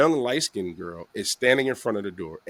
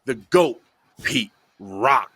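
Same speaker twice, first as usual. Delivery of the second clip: hype guest introduction; 6 semitones higher than usual. Real, not acted. Host introducing a legendary guest, hyped announcer build-up. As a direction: roll out the titles like an MC.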